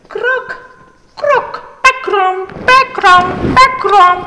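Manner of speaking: high, loud croaking frog noises; building frog calls. Acted. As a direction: croak like a cartoon frog for kids. Teacher imitates frog croak.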